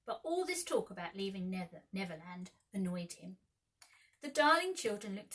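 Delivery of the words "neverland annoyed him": usual speaking voice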